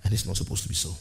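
usual speaking voice

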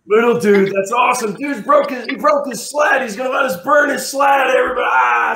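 high, loud, whooping excitement; rowdy cheering teens. Retold or imitated. High schoolers cheering the boy's broken sled wood, excited shouting. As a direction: holler it like a thrilled teenage crowd.

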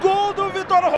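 loud, high goal call; goal shout. Real, not acted. Goal scream by commentator.

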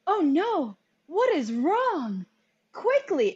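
mock super-dramatic cartoon panic, pitched high and loud; hammed-up alarm with drawn-out words; over-the-top acting. Mocking a cartoon's campy acting for the co-hosts, super dramatic. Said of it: "it's a little bit over the top".

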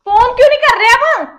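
sharp, loud insisting; loud angry demand to her husband. Acted. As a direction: push him hard to make the call. Wife demands husband call brother.

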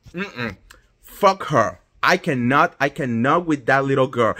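ordinary talk, nothing marked

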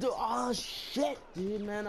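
sudden high yelp of shock; startled outburst. Real, not acted. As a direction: blurt it out, caught off guard. Surprised gamer outburst.

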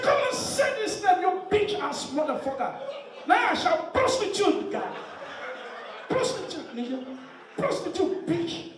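high-pitched mock American girl; hammed-up high American voice. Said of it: "I know American girls, their ringtone is different".

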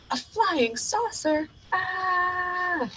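high slow alarmed gasp; startled alarm. Acted. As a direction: react to sudden danger. Alarmed at saucer.

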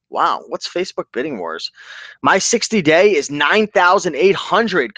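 usual speaking voice

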